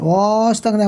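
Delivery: ওয়াস drawn out on its vowel before তাগ না; mistaken long vowel. Shown as a demonstration. Teacher showing how not to read it, stretched vowel. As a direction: drag the opening vowel of ওয়াস. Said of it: "এমন হবে না".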